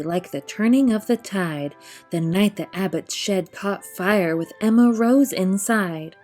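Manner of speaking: usual speaking voice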